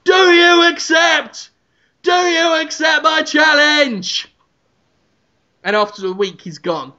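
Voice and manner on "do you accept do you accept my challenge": high, slow bellowed demand; Ramsay marching outside, shouting his challenge high and slow; repeated challenge shout. Acted. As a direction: pound the words like fists on glass. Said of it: "just shouting".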